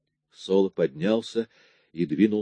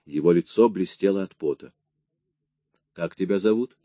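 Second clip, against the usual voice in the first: ordinary delivery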